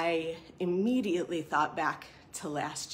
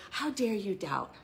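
mock rebuke; 6 semitones higher, 4 dB louder than usual. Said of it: "God didn't say".

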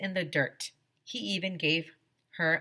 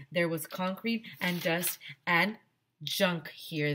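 ordinary delivery

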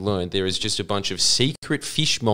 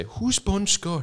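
clueless friend; 11 semitones higher, 6 dB quieter, much slower than usual. Retold or imitated. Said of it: "he'd turn around and say".

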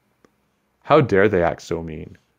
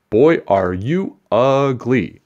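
slow, high, loud jeering taunt; sneering bully; 5 semitones higher, 5 dB louder, much slower than usual. Acted. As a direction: drag out the insult like a mean big kid.

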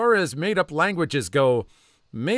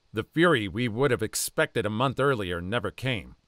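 ordinary delivery, nothing marked

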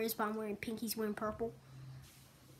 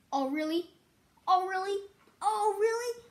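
each "Oh, really?" climbs higher; rising mocking taunt; 7 semitones higher than usual. Real, not acted. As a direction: tease harder every time. Repeating taunt, rising each time.

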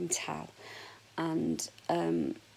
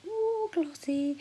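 high admiring coo; 12 semitones higher, much slower than usual. Real, not acted. Admiring her shiny hair.

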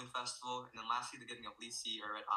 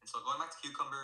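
ordinary delivery

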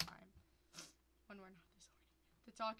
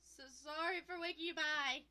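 a much higher, louder irritated woman; voiced angry neighbor woman; 5 semitones higher, 7 dB louder than usual. Neighbor woman confronts the player at her door, annoyed. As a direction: confront him like a woken neighbor.

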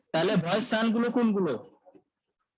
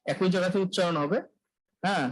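ordinary talk, nothing marked

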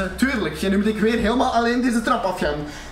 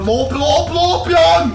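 urgent; 5 semitones higher, 5 dB louder, much slower than usual. Real, not acted. Urging himself to run.

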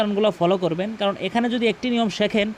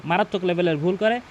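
ordinary talk

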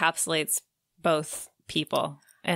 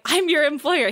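mimicking Olympia; 8 semitones higher, 4 dB louder than usual. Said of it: "she's just constantly like".

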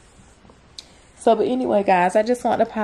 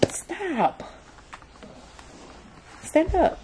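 sharp stop; 9 semitones higher, 7 dB quieter, much slower than usual. Real, not acted. Mom telling her squirming toddler to stop, sharp and high. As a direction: cut her off mid-fuss.

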